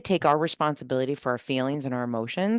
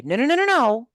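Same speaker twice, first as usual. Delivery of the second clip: rapid-fire higher, louder no-no-no; emphatic objection; 7 semitones higher, 6 dB louder than usual. Retold or imitated. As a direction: cut off their excuse, raised pitch and volume. Retelling firm rebuttal to team member.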